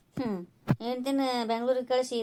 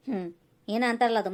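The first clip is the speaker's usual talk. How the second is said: ordinary talk, nothing marked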